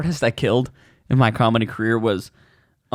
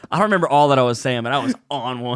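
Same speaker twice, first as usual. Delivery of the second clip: ordinary delivery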